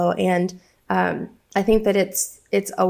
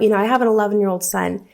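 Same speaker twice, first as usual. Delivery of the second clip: normal talking voice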